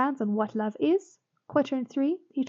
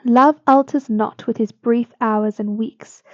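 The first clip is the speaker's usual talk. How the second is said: slow, louder, declaimed sonnet line; poem recitation; 8 dB louder, slower than usual. Acted. Teacher reading Shakespeare's line to students, slow and declaimed. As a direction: read it out like verse on a stage.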